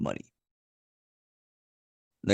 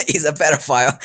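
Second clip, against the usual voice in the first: higher, amused through giggles; laughing; 6 semitones higher, 4 dB louder, faster than usual. Real, not acted. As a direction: blurt it out between laughs. Laughing at own absurd story.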